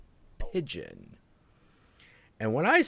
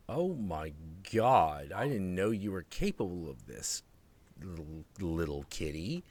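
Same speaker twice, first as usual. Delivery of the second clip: hushed awe; 7 semitones lower, 9 dB quieter than usual. Retold or imitated. Retold awe at the cat.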